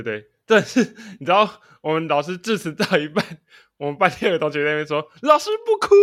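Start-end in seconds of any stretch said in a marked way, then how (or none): 5.2-5.9 a sudden high piping "don't cry, teacher"; high, loud mock consolation; mock comforting classmate. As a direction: squeak it out like a kid consoling the teacher. Classmate's sudden line at ceremony.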